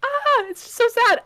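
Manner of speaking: high, loud mock-wailing sadness; high theatrical lament; high anguished lament. Real, not acted. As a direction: whine how sad it is. Reacting to death in book.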